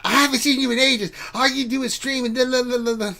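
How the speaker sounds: high-pitched mock-mom complaint; exaggerated high nagging, trailing into la-la. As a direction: imitate a scolding mother, high and singsong. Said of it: "Mom yelled at me".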